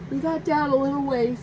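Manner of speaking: words stretched by a big yawn; yawning mid-sentence; through a yawn. Real, not acted. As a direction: talk while yawning. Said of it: "I don't know why I'm yawning".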